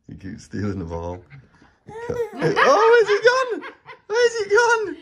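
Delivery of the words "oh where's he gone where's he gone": laughing. Real, not acted. Playing hide and seek with dog.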